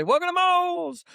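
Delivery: high, slow, sing-song shop greeting; cheery high-pitched welcome shout; Moe's greeting. Retold or imitated. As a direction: call it out like staff greeting customers at the door. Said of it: "I like when they say".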